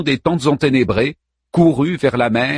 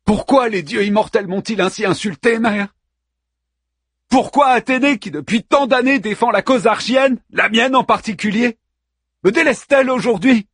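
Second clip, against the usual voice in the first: indignant hero; 10 semitones higher than usual. Acted. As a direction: demand why the gods betrayed you. Achilles protests to the gods.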